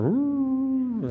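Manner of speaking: high howling woo like dogs at a siren; imitated dog howl. Preacher mimicking dogs howling at an ambulance, high howl. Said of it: "all the dogs in the neighborhood start".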